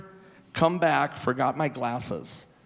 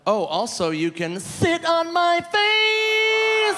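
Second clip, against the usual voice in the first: high, slow, sing-song taunt; mocking taunt; 14 semitones higher, 4 dB louder, much slower than usual. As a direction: drawl it out high and teasing. Taunting the video store guy.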